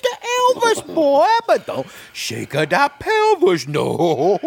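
high, loud mock drawl of a film manager; Colonel Parker impression. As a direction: ham it up, warning the boy off shaking his pelvis. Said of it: "he talk like this".

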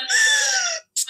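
a sharp high gasp of shock. Retold or imitated. Hearing she is thirty weeks pregnant, a shocked gasp. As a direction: gasp as if the news just hit.

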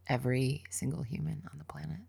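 a fast, breathless whisper; whispered question. Real, not acted. Host asks guest the big closing question in a whisper. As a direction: hush it like a secret.

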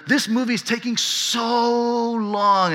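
"so" drawn out high and slow; stretched so. Retold or imitated. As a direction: stretch "so" into a long whine. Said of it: "they emphasize the S O O O O".

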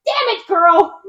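lightly voiced southern grandma snapping; a hint of southern Nana barking at the girl; mock southern snap. Southern grandma Nana snapping at Laura. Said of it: "Nana instantly changes her tone".

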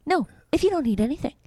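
high, indignant jealous scolding; jealous demand. Real, not acted. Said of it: "my jealousy".

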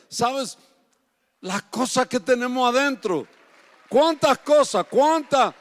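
loud, ringing preacher's voice, driving each phrase home; preaching at full force. Acted. Preacher urging the congregation to open their hearts, loud and fervent. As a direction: preach it hard to the whole congregation.

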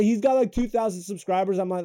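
normal talking voice

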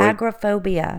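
agoraphobia said as AG-ra-phobia; dropped O. Real, not acted. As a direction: stress AG and swallow the O in agoraphobia. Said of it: "No, agoraphobia, you, there's an O".

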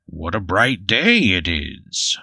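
slow, drawn-out Bigfoot character voice. Acted. Game master introduces Bigfoot stepping into the sun, slow creature voice. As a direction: stretch it out like a big folksy creature. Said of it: "That's how he talks".

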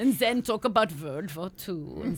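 hammed-up German accent. Shown as a demonstration. Improvising actress slips into a German accent for laughs. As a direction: put on a mock-solemn German accent. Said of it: "I would go to the German accent".

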